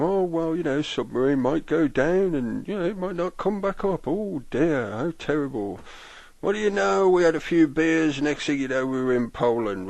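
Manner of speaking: high, loud, gleeful mock voice; mocking lobbyist. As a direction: ham up a smug chuckling official. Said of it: "he's laughing about the idea of".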